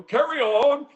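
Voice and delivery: high-pitched mock British accent; put-on British accent. Sensei to the class after demonstrating, mock British accent. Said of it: "British saying in a British accent".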